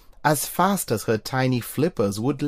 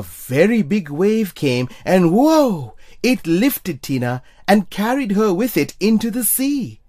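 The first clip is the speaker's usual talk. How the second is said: excited; 7 semitones higher, 5 dB louder than usual. A narrator's reading. Big wave lifts Tina.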